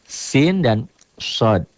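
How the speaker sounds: "Sin dan sod" spoken slowly, each letter drawn out; slow, careful teacher demo of "Sin dan sod". Shown as a demonstration. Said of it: "Sering orang membaca sama".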